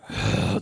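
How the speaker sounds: heavy put-on sigh; exasperated sigh. Disney World Frenchman losing patience, exasperated sigh. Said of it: "Exasperated Frenchman".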